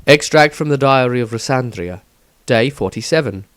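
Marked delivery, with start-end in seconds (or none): none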